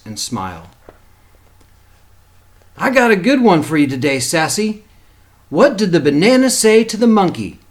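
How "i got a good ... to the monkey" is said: higher, louder, quicker joke setup; joking uncle. A narrator's reading. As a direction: set up the joke with gusto. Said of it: "Uncle Red tells a joke".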